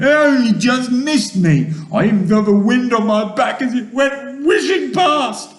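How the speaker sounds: high, loud complaint; distressed high-pitched lament; wailing. A narrator's reading. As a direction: wail about a narrow escape. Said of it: "wailed the earthworm".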